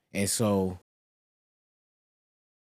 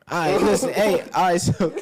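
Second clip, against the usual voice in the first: laughing wrap-up; 6 semitones higher, 4 dB louder than usual. Real, not acted. Laughing wrapping up.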